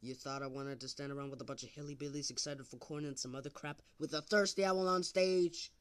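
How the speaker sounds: overacted amateur dub. Scornful rant.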